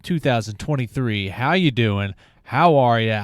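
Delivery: ordinary talk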